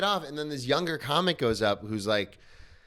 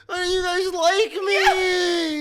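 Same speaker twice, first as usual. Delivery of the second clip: much higher, slow, loud wail with laughing sobs; hammed-up wail; 6 dB louder, much slower than usual. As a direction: whine it like a crying kid, half laughing. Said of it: "I'm over here being like".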